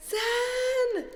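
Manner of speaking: high excited call of a name; happy shout-out to a donor. Real, not acted.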